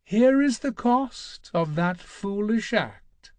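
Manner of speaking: silken malice. Acted. Ares taunting Kratos before the cost, silken malice. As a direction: savor each word like a threat. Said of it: "The fire's voice softened to silken malice".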